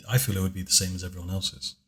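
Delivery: normal talking voice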